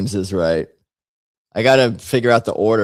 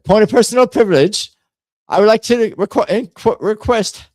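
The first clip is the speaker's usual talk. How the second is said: much higher and louder pompous British voice; very formal British accent; 11 semitones higher, 6 dB louder than usual. Acted. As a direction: raise a point of order like a puffed-up MP. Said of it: "I wanted to be very formal".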